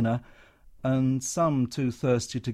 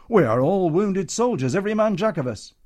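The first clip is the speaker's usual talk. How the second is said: indignant protest; 7 semitones higher, 8 dB louder than usual. Acted. Comedian protesting to Matron.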